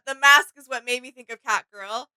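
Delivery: usual speaking voice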